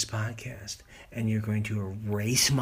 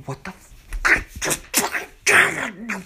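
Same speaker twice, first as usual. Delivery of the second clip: strained, much higher and louder yell; furious outburst; 10 dB louder than usual. Real, not acted. Podcaster raging at his recording setup, furious yell. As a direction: explode into a high-pitched swearing fit.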